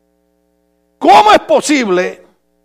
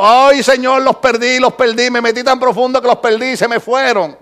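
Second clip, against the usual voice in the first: predicador desorientado. Retold or imitated. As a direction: imitate a preacher lamenting in a raised voice. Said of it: "levantó la cabeza y dijo". (predicador que se lamenta, imitado)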